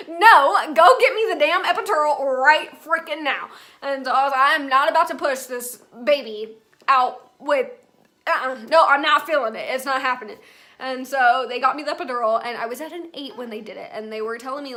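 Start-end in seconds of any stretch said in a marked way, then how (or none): 0.1-10.4 loud, comic reenactment of refusing; emphatic demand. Retold or imitated. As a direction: insist forcefully, allowing no argument. Demands epidural, refusing to push.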